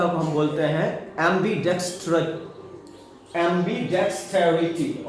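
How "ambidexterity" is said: "ambidexterity" slowly as am-bi-dex-te-ri-ty; slow teacher's breakdown of "ambidexterity"; syllable by syllable. Shown as a demonstration. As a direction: sound out "ambidexterity" in measured pieces. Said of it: "हम कैसे तोड़ के इसको पढ़ते हैं".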